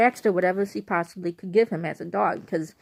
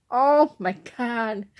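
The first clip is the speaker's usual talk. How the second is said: appalled; 3 semitones higher, 3 dB louder, much slower than usual. Retold or imitated. Reacting to horseradish news.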